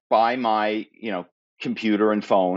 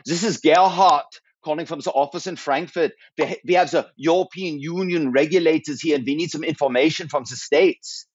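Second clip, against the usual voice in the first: higher-pitched put-on German accent; 5 semitones higher than usual. German office head phoning a US executive, in a German accent. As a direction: pose as a German colleague with an accent. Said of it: "So I would be doing some accent".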